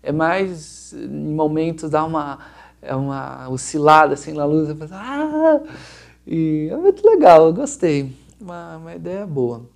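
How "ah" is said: high, loud drawn-out "ah"; imitated light burst. Shown as a demonstration. Designer imitating the lights' swell for viewers, high loud drawn-out ah. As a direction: let an "ah" soar up high, far above talking pitch.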